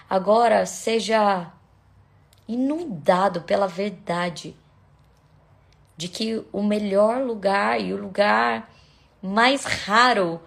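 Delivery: ordinary delivery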